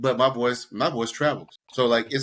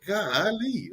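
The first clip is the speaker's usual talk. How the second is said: drawn-out, higher-pitched marvel; mock amazement; 8 semitones higher than usual. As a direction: savor it in playful awe. Mock reaction to toxic women's tricks.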